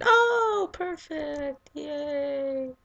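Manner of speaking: high squeaky mock cheer; sarcastic squeaky voice. Real, not acted. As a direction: squeak out a fake yay.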